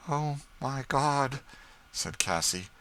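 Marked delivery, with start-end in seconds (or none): 0.1-1.4 slow, higher stricken cry; overwhelmed just before fainting; shocked gasp. A narrator's reading. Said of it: "fell insensible on the floor".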